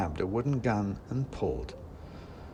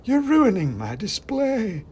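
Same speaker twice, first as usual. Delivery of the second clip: much higher, louder, slow wail; upset toy maker; 12 semitones higher, 7 dB louder, slower than usual. A narrator's reading. Toy maker protesting his ruined display, high and slow. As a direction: wail it like a fussy little craftsman.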